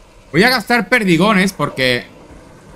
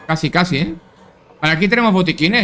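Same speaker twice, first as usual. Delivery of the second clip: ordinary talk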